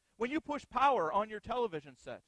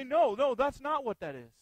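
denying; 3 semitones higher, 9 dB louder than usual. Pretend. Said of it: "we'll try to deny it".

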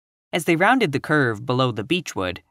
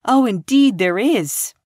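put-on high, loud, drawn-out girlish objection; crying out; 9 semitones higher, 5 dB louder than usual. A narrator's reading. Anne unable to endure such heresy, crying out. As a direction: cry it out slowly in eager protest. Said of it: "cried Anne".

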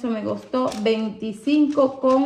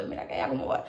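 whispered, much softer; gossiping whisper; 12 semitones lower, 9 dB quieter, much faster than usual. Retold or imitated. Woman retelling gossip with a friend, whispered. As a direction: hush it like sharing juicy gossip.

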